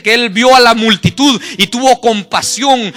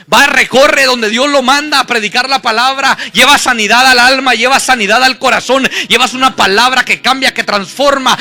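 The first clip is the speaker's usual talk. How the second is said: raised, driving preacher's delivery; predicación enérgica; 3 semitones higher, 6 dB louder than usual. Acted. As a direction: preach it in punchy parallel phrases. Preaches with rising force. (predicación enérgica con voz elevada)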